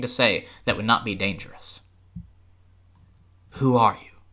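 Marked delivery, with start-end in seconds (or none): none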